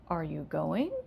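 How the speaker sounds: going rises sharply at the end; rising question. Shown as a demonstration. Teacher modeling a yes-no question rising. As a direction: lift the voice on going. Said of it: "the intonation is gonna rise".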